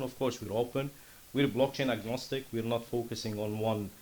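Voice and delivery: ordinary talk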